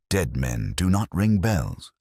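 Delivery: ordinary delivery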